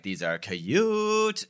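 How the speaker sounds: slow, drawn-out incantation; magic words. Acted. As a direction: stretch it out like a spell. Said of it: "she says the magic words".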